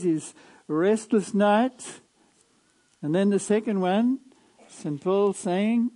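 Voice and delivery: loud, emphatic pulpit preaching; fervent preaching. Acted. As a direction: preach it out with fire. Preaching at full force.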